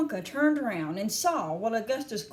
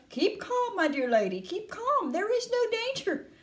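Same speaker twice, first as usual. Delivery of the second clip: high-pitched insistent soothing; high, emphatic Mr Wonka; crying reassurance; 11 semitones higher than usual. A narrator's reading. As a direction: insist all is fine, pitch held high. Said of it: "cried Mister Wonka".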